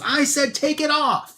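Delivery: high-pitched mock yell; yelled order. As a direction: cut in with an exasperated, acted yell. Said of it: "and he yells".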